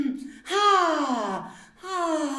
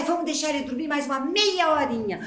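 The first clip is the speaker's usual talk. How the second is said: ordinary delivery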